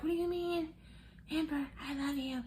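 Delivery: high, small pleading voice; mock pleading Puppy. Puppy begging Amber in an argument, high mock pleading. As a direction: plead like a wounded innocent.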